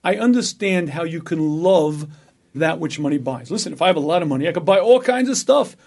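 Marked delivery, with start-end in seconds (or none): 4.5-5.8 excited. Pretend. Imagining wealth.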